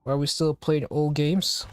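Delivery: normal talking voice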